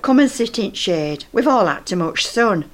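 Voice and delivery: normal talking voice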